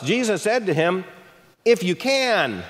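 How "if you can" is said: higher, exclaimed echo falling at the end; thrown-back retort. Retold or imitated. Jesus throwing the father's statement back at him, challenging. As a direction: toss the words back in challenge. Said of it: "throwing the statement back at him".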